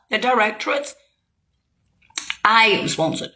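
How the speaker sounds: ordinary talk, nothing marked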